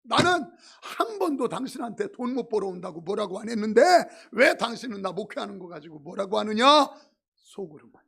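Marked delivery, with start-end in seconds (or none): 0.0-6.6 louder, half-laughing angry shout at his wife; mock yelling. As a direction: pretend to blow up, but with a laugh in it. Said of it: "큰 소리로 막 소리쳤어요".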